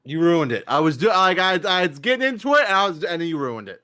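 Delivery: worked-up mock grievance; mock indignation. Real, not acted. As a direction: complain that the bit got spoiled. Mock complaint about spoiled bit.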